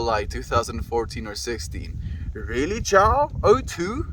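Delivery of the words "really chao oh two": surprised. Real, not acted. Shocked at chatter's age.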